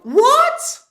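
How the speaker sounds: loud, high-pitched shocked question; astonished outburst. Acted. Reviewer acting out a viewer hit by a plot twist, astonished. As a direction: shoot way up in disbelief. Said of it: "then suddenly you go".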